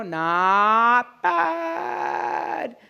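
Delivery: each word stretched very long; drawn-out verdict. Pretend. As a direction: hold both vowels for comic show. Drawn-out mock praise, repeated for show.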